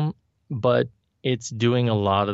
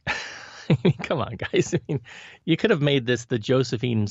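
ordinary talk, nothing marked

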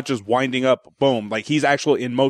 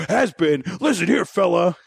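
lightly affronted, raised-pitch comeback; mock indignation; 6 semitones higher than usual. Insulted boxer getting in his rival's face, mock indignant. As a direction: play at squaring up to the insulter.

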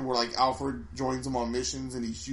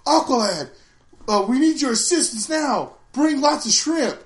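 lightly voiced cartoon hero, higher and louder; hammed-up cartoon Robin giving orders; Robin's voice; 11 semitones higher, 7 dB louder than usual. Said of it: "Robin comes on".

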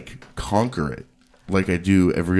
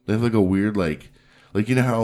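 normal talking voice

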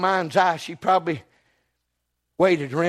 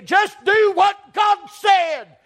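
much higher and louder, full-force preaching; preaching shout; 13 semitones higher, 6 dB louder, much slower than usual. Acted. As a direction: shout the exhortation to the church. Preacher exhorting congregation at climax.

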